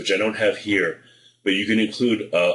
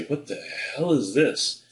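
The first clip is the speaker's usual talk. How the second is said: slow, higher, softer puzzled exclamation; baffled recipient; 5 semitones higher, 4 dB quieter than usual. Retold or imitated. Lawyer's prospect finding a bank bag in the mail, mock-baffled. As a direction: voice a stranger squinting at weird mail. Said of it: "they're gonna say".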